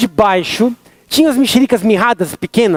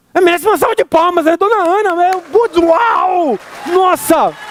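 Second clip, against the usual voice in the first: amazed; 9 semitones higher than usual. Retold or imitated. Amazed reaction to mom.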